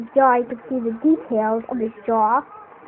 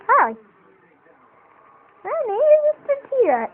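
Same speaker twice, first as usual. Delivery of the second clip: much higher, louder cartoon dinosaur voice; silly puppet voice; 10 semitones higher, 7 dB louder than usual. Shown as a demonstration. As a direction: squeak it out as a goofy puppet. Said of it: "You don't want to do that".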